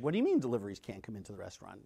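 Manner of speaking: quick stunned echo of the news; incredulous repeat. Retold or imitated. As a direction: rattle it back at him, can't believe it.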